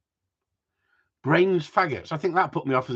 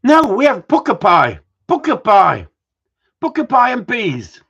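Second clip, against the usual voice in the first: higher and louder, insisting on pucker pie; loud insistence; 9 semitones higher, 11 dB louder than usual. Real, not acted. Husband loudly correcting his wife about pies, insistent. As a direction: call it out, adamant and loud.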